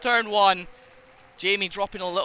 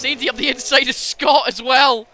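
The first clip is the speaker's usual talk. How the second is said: excited shout; 5 semitones higher, 4 dB louder than usual. Real, not acted. Excited shout over a pass.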